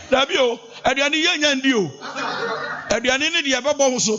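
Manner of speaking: high-pitched teasing scold with laughs; hammed-up high-pitched wife; high laughing mock scold. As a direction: squeak it out while laughing.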